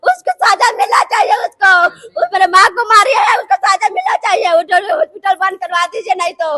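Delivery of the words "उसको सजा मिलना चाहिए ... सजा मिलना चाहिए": crying out, voice raised for justice; weeping, furious plea; tearful demand. Real, not acted. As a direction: shout through tears for punishment. Daughter demands punishment while crying.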